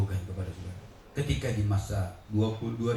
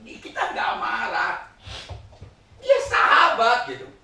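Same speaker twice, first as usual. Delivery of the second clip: loud, high, laughing sermon story; fiery preaching; 13 semitones higher, 8 dB louder than usual. Acted. Preacher storytelling at full force.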